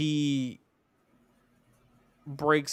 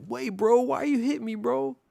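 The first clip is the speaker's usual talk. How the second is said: high-pitched, aggrieved imitation; comic bewildered complaint, pitched way up; mock protest; 11 semitones higher than usual. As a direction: whine up high like someone wrongly hit. Imitating man protesting being hit.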